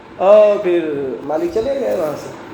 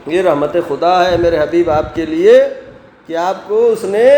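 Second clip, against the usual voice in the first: usual speaking voice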